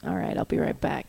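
breathy whisper of the neighbor; hushed, as if not to wake anyone. Retold or imitated. Upstairs neighbor whispering to his partner. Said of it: "something like this".

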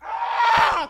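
sudden high, loud scream; matchstick scream. Acted. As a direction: burst out screaming like a struck match. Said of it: "This is my impression of a matchstick".

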